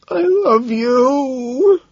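high, very drawn-out wail; miserable wail. A narrator's reading. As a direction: wail your love in misery. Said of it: "he miserably wails".

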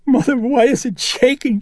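put-on girl crying to her mother as the bed shakes; frightened cry. A narrator's reading. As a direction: cry out in panic. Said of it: "she cried".

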